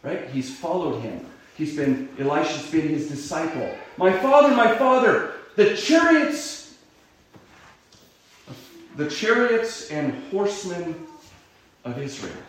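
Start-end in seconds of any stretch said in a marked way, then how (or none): 4.0-12.4 loud high cry, fading at the end; loud grieving cry that trails off. Acted. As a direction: call after him in anguish. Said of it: "he cries out".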